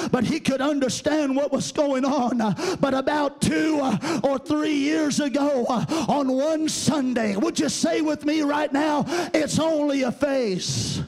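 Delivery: rhythmic, ringing phrases punched out with "uh"; full-force preaching. Real, not acted. Preacher testifies to the church about the boy, fervent preaching.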